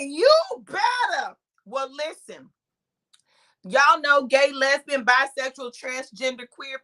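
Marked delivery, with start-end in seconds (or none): 0.0-1.4 high, slow, drawn-out cheering on; imitated hype. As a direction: stretch it out high, hyping a passerby. Imitating hyping onlookers.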